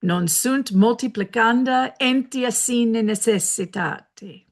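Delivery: a mock Italian accent on the Latin, only lightly done; terrible Latin accent. Shown as a demonstration. To Italian-speaking viewers, a bad Italian-accented Latin. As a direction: hams up Italian Latin, badly. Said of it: "I know that accent was terrible".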